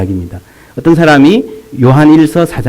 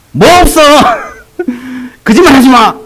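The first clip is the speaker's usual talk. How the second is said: much higher, louder mock outrage; mock indignant retort; 10 semitones higher, 3 dB louder than usual. Preacher acting out how we'd rebuke the woman, mock outraged. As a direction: snap back as if catching a liar, hammed-up. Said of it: "이러고 싶잖아요".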